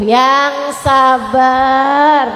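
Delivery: "yang sabar" stretched out very slowly; lingering, patient advice; drawn out. Acted. Preacher drawing out advice. ("yang sabar" diucapkan sangat pelan dan panjang)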